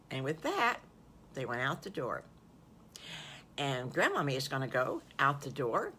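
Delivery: usual speaking voice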